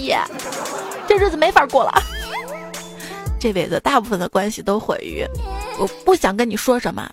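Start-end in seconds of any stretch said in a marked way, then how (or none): none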